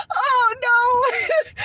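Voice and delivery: high, loud cackling laugh then oh no; shrieking laugh. Real, not acted. Recalling the awkward clinic visit, loud helpless laughter. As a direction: laugh hard in disbelief.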